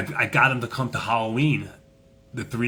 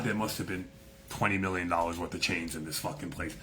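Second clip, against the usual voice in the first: normal talking voice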